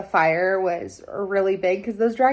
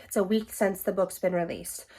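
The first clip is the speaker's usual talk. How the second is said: ordinary talk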